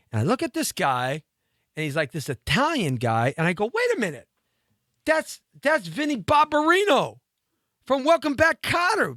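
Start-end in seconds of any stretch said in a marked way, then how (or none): 3.7-9.2 high-pitched, loud disbelief; hammed-up incredulous discovery; astonished recognition. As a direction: pipe up high as the penny drops. Acts out recognizing the actor.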